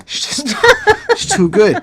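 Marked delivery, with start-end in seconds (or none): 0.6-1.1 loud, high burst of laughter; delighted laugh. Real, not acted. Reviewer tickled by a clever lyric, delighted laugh. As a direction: crack up at how good it is.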